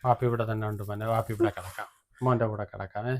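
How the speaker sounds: soft, low hushing; father whispering his boy to sleep; soothing lull. Acted. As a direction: lull him gently to sleep. Father lulling son to sleep.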